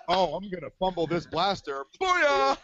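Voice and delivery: building to a loud Booyah; mock fumble cheer. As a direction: cheer it like a cheesy ad. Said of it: "like he intentionally did that".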